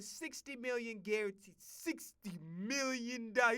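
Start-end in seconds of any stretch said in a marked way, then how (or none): 1.6-3.6 slow, drawn-out amazement; savoring the figure. Real, not acted. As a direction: let each word sink in.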